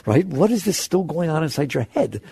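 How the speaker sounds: ordinary talk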